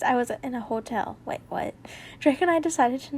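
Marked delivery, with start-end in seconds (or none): 1.2-1.7 quick unvoiced whisper of disbelief; whispered surprise. Real, not acted. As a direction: mutter it under your breath in shock. Surprised aside while reading.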